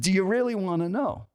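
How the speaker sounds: imitating an insistent stranger's challenge; retold pointed challenge. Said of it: "now he wasn't shouting".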